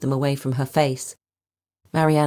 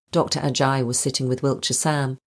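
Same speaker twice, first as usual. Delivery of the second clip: ordinary delivery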